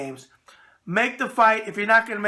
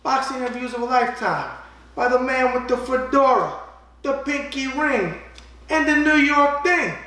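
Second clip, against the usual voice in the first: ad read; 3 semitones higher, 5 dB louder than usual. Acted. Host plugging his boxing book to viewers, hammy sales pitch. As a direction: hawk the book like a New York huckster.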